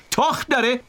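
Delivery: high-pitched yell across water; shouting from shore. A narrator's reading. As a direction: call out high to someone far off. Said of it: "ارنی از کنار ساحل داد زد".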